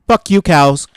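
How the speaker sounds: loud angry yell; shouting. Acted. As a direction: yell it at the cows. Horse yells at cows.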